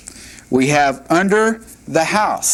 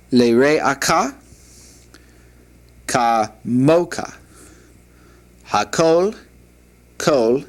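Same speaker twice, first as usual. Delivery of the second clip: slow Hebrew read with long pauses between words; slower than usual. Shown as a demonstration. Teacher reads the verse slowly for students to practise along. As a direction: read each Hebrew word slowly so students can follow. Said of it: "I'll try to go slow".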